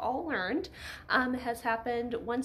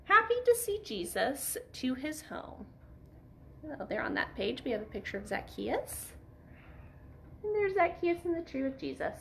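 plain reading voice

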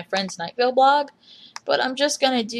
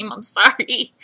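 loud, real laughing sorry; laughing apology; 3 semitones higher, 7 dB louder than usual. Real, not acted. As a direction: laugh through the apology, embarrassed. Laughs, embarrassed after bad impression.

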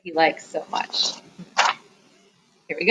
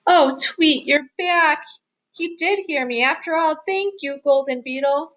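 high, excited mouse voice; relieved and thankful, pitched up; overjoyed; 8 semitones higher, 3 dB louder than usual. A narrator's reading. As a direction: greet a lost friend with delight. Relieved mouse greets revived friend.

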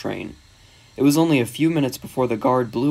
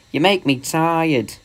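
slow, higher weary complaint; exasperated; 4 semitones higher, slower than usual. Acted. James fed up with the quarrelling engines, exasperated. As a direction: draw it out wearily.

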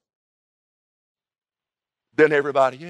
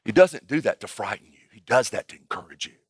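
usual speaking voice

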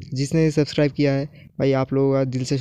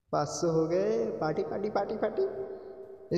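whispered celebration; 5 semitones higher, 11 dB quieter than usual. Real, not acted. Quiet celebration.

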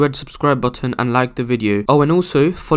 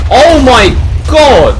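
long, high, loud cry; panicked yell; 11 semitones higher, 11 dB louder, much slower than usual. Real, not acted. Gamer under falling meteors, panicked yell. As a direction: stretch it out in loud alarm.

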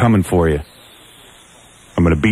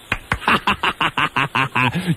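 gloating laugh; 4 semitones higher, 4 dB quieter than usual. Real, not acted. Gloating after taunts.

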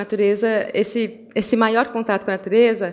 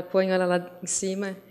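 normal talking voice

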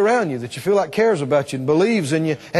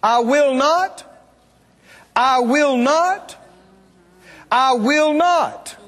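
"I will not" high and drawn out, rising, finally falling; emphatic triple repetition; 7 semitones higher, much slower than usual. Shown as a demonstration. Preacher showing the Greek emphasis, "I will not" slow and high. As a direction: stretch every word of "I will not", pausing between repeats. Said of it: "it's emphasized like that".

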